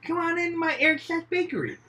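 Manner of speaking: very high, sing-out sales invitation; silly high-pitched pitchman; bakery owner. Acted. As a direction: call customers in with a squeaky pitch. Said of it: "I totally just see him".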